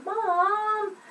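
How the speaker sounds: high, soft, drawn-out call; hushed singsong call for a parent; calling out to Mom. Shown as a demonstration. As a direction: call softly to someone nearby, stretching the word. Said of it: "maybe the person's right there".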